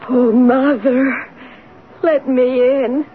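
slow, drawn-out ghostly plea; pleading voice. Acted. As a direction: stretch each word into a tearful wail. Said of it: "tell me about this pleading voice".

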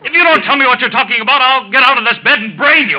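loud, high, raging shout; furious threat. Acted. Sick man threatening the doctor, furious shout. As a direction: threaten the quack at full force.

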